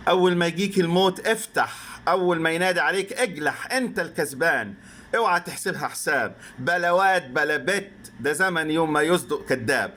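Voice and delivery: fast, high-pitched Arabic recital. Retold or imitated. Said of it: "So his voice, the, uh, poet's voice, uh, raises here".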